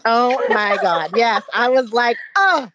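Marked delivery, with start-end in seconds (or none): 2.4-2.8 laughing Oh. Real, not acted. Laughing reaction to the compliment.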